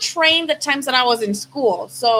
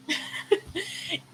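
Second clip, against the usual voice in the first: soft unvoiced chuckle; a little breath of a laugh; quiet breathy laugh; 5 semitones higher, 12 dB quieter than usual. Real, not acted. Laughing at own worry.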